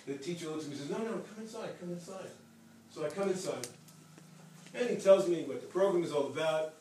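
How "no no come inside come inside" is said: high, soft urging, lightly acted; beckoning invitation. Teacher to a visitor at the door, beckoning. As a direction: imitate someone gently waving a visitor in.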